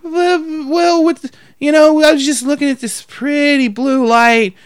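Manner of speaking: high, rambling imitation; dazed crazy lady. Crazy lady entranced by a blinking light, high dreamy imitation. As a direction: wander off, entranced by the thought. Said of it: "She's just like".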